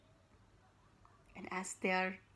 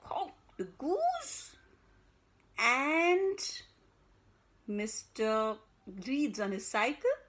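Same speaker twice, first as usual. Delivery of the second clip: ordinary delivery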